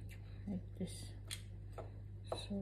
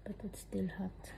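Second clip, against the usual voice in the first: whispering; 2 semitones lower, 9 dB quieter than usual. Real, not acted. Whispered aside.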